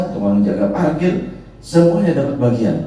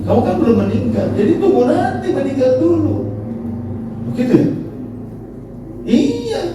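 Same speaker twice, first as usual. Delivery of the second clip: explaining angel; 12 semitones higher than usual. Retold or imitated. Angel telling Idris he must die first, high voice.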